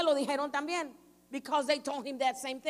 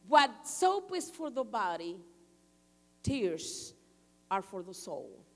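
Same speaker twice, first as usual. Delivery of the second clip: tender teaching; 6 semitones lower, slower than usual. Real, not acted. Sighed, slow proverb.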